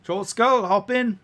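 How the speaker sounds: high, loud driver's call; woman carriage driver. Acted. Cab driver to new passengers, loud high holler. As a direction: holler to passengers to climb aboard.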